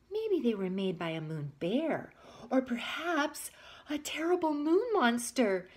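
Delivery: frightened whisper rising on monster; scared whisper. Acted. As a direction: whisper it, growing spooked.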